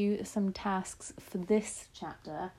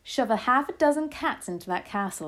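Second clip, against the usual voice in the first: higher, louder eager cry; 5 semitones higher, 5 dB louder than usual. A narrator's reading. Child urging the cat plan, crying out. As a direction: urge it on loudly. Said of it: "I cried".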